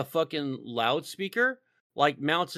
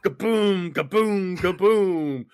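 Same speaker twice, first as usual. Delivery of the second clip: higher, louder kaboom sound effects; mock explosions; 5 semitones higher, 5 dB louder, slower than usual. Playing tank gunner at the Russians, louder booming kabooms. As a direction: blast it out like tank fire.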